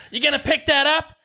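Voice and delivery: high snippy scold at a litterer; a high mock lady snapping from her car. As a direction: snap at him like an irritated stranger in her car. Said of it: "immediately snaps and goes".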